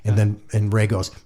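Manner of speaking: ordinary talk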